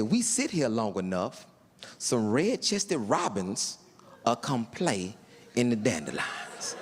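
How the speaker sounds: lightly voiced dreamy birdwatcher; mock dreamy. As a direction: savor it like a nature lover. Tim describing robins.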